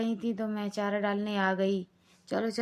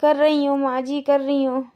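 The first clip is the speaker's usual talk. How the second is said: pleading loudly; 4 semitones higher, 7 dB louder than usual. Acted. Lajo pleads while being scolded.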